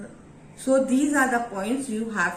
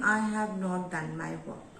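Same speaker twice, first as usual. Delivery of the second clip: softer and lower, confessing to the teacher; low sad tone; 4 semitones lower, 5 dB quieter than usual. Shown as a demonstration. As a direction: let the voice sink, ashamed of unfinished work. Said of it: "your volume of the voice goes down".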